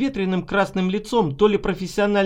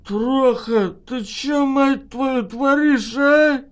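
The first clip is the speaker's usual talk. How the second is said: put-on higher, louder drunken outburst; drunk nasal bluster; 6 semitones higher, 3 dB louder, much slower than usual. A narrator's reading. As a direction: slur it, drunk and indignant. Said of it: "прогугнил Алексей".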